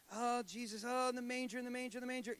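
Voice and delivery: soft, cooing mock adoration; hushed sing-song mock reverence; mock sentimental gushing. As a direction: gush softly over it, mockingly. Mocking sentimental nativity admirers.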